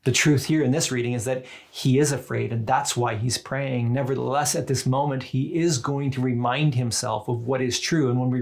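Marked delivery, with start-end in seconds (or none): none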